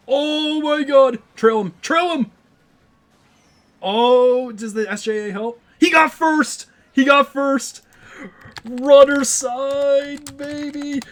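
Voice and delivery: high, loud, stretched-out cheering; excited screaming. Real, not acted. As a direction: yell with pure joy at the win. Big win during live race.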